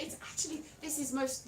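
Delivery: normal talking voice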